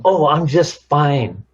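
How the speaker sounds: slow, huffy insistence; argumentative "fine". Acted. Spouse replying in an argument, huffy. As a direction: insist huffily, stretching out "fine". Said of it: "that tone of voice".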